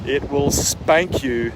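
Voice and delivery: ordinary talk, nothing marked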